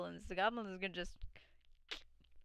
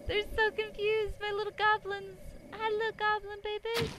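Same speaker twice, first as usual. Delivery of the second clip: sweet high talk to little goblins; 8 semitones higher than usual. Real, not acted. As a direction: coo at them like babies. Cooing at goblin enemies.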